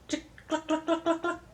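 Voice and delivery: high, dry imitated hen clucks; chicken clucking. Grandma playing the pollo for grandchild, clucking. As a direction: cluck in quick little clicks. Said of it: "And the chicken goes".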